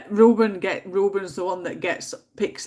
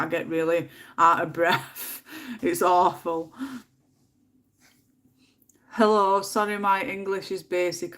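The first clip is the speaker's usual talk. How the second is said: ordinary delivery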